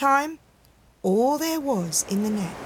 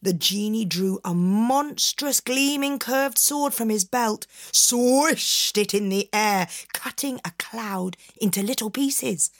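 plain reading voice

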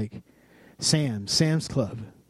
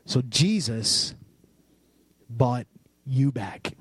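slow, weighty sermon emphasis; slow emphatic preaching; 2 semitones lower, slower than usual. Real, not acted. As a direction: let each word land slowly.

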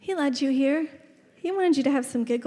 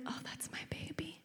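hushed whisper of a new mother; barely audible, acted awe; awed whisper; 21 dB quieter than usual. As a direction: whisper it to yourself in wonder. New mother hears baby's cry.

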